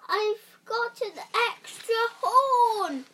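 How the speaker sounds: high, loud whine; whining unicorn. A narrator's reading. Unicorn whining to the doctors, high loud whine. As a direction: whine it, sorry for yourself.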